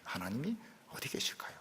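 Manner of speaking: voiceless, secretive whisper. Real, not acted. Preacher whispering to the congregation, secretive hush. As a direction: whisper as if peeking for someone hidden. Hushed question about hiding God.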